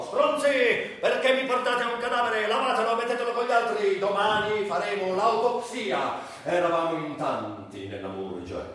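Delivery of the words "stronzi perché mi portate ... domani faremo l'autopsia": higher-pitched mock yell of a rude doctor; on-duty doctor yelling at the orderlies, hammed-up shout. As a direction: bark it like a fed-up ER doctor. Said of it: "il medico di guardia urlò".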